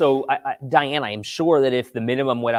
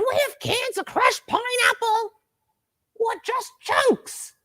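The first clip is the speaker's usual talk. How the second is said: much higher, stretched-out shrill call; mom's shriek; slower than usual. Retold or imitated. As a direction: copy mom calling down the stairs. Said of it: "that is exactly how she said it".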